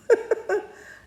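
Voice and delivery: a burst of airy, high laughter; high breathy laugh. Real, not acted. Mother laughing at her daughter's snub, high airy laugh. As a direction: laugh out loud at the memory.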